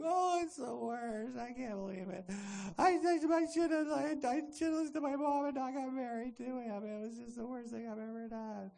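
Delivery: high mock sobbing complaint, sagging at the end; hammed-up despair, stretched and whiny; wailing passive victim. Victim regretting her marriage, exaggerated high wailing. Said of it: "that passive victim".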